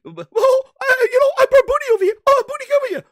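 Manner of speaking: very high, loud cartoon falsetto; squeaky mascot showing off a friend; Mickey Mouse voice. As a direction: call out in a piercing mouse falsetto. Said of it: "He, he talks like this".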